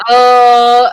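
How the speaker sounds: loud, drawn-out mock "uh"; loud put-on stalling sound; imitated reaction. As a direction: blurt out a big acted hesitation. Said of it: "I'd be like".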